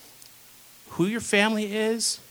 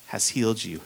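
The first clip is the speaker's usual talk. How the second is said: tender blessing; 5 semitones lower, 3 dB quieter than usual. Retold or imitated. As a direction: speak it gently like a blessing. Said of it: "He said to her".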